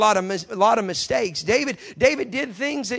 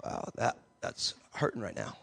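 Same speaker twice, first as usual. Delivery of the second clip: lower, soft, unvoiced mock calm; 11 semitones lower, 12 dB quieter than usual. Preacher mocking a calm person whose feet are on fire, hushed whisper. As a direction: whisper it as if fire didn't hurt. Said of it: "you're not gonna be like".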